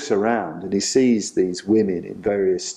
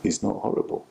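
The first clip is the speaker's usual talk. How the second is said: hushed, almost whispered calm; quiet, settled reassurance; calm Buddha; 4 dB quieter than usual. Retold or imitated. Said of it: "just saying".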